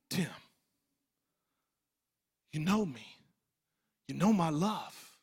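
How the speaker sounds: soft, low and patient, with long pauses; gentle voice of God. Retold or imitated. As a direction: speak it tenderly, as God reassuring one person. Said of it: "He was gentle".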